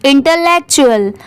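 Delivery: इंटेलेक्चुअल said clearly for students to repeat; repeat-after-me model. Shown as a demonstration. Teacher says it for class to repeat, clear model pronunciation. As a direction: give इंटेलेक्चुअल as a clean model word. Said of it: "आप भी मेरे बाद repeat करिए".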